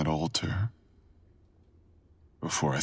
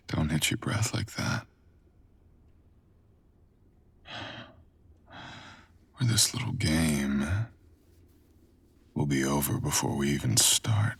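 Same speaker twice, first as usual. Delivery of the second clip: teasing whisper; 2 semitones lower than usual. Acted. Seducer warning the nervous listener to breathe, whispered tease. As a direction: hush them with a playful threat.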